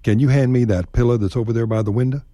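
quick everyday line with pillow as pilla and window as winda; casual Texan request. Shown as a demonstration. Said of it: "Say window and pillow".

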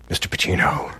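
breathless hushed address; an obsequious usher whispering to a celebrity; timid whisper. Retold or imitated. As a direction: whisper his name like a nervous fan. Nervous employee approaches a star.

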